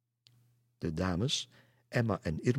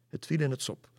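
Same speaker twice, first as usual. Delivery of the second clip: ordinary delivery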